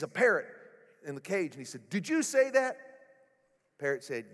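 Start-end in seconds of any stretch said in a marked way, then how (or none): 1.9-2.8 startled burglar. A narrator's reading. Startled burglar asks the parrot.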